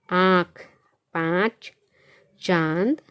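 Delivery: आँख, पाँच, चांद loud, each vowel through mouth and nose; nasalised anunasik vowel. Shown as a demonstration. Teacher repeating anunasik examples for pupils, आँख, पाँच, चांद loud and nasalised. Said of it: "मुंह से भी आवाज़ निकल रही है और नाक से भी".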